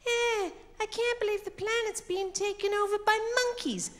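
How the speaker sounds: high-pitched mock-dramatic film hero. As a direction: strain it high in mock disbelief. Impersonates film hero [name].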